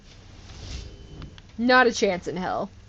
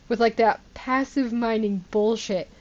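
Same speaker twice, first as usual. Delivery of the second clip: ordinary delivery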